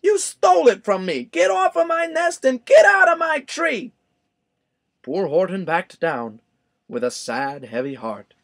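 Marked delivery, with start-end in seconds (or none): 0.0-3.9 much higher, louder furious bird shout; angry shout. A narrator's reading. Maisie ordering Horton out of the tree, shouting. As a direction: drive him off the nest.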